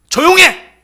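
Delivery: short, high bark to silence them; sharp rebuke. Retold or imitated. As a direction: snap at the beggars to shut up. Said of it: "이렇게 강력하게 꾸짖음이었어요". (날카롭게 높이 쏘아붙이듯 "조용해!")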